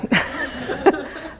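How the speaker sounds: airy laugh. Real, not acted. Laughing at own story.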